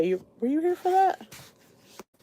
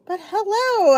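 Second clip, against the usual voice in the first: perky hello; 10 semitones higher, 4 dB louder, slower than usual. Real, not acted. Greeting viewers after tired whisper.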